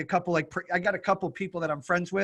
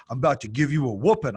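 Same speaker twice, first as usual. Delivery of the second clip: put-on British accent; 4 semitones lower than usual. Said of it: "he was from the U K".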